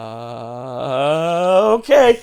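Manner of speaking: mock-stunned long uh, then a punched okay; taken aback. Teller replays walking in on someone undressed, taken aback.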